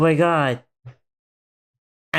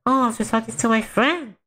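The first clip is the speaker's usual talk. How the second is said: put-on higher, innocent little girl; higher child's voice answering her mom; 7 semitones higher than usual. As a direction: play it small and innocent. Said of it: "she just goes like".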